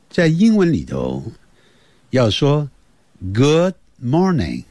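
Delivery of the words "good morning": Good morning spoken slowly and clearly for learners; slow model phrase. Shown as a demonstration. Teacher modelling Good morning slowly for kids. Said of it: "现在就要注意听了".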